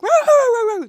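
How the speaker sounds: loud, high snarling bark imitation; imitated dog growl. Groomer mimics a dog snapping at the brush, loud growl.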